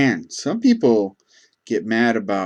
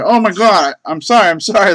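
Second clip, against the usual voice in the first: higher, louder rushed sorry; woman apologizing again, embarrassed; flustered apology; 9 semitones higher, 8 dB louder than usual. Retold or imitated. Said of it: "all apologetic".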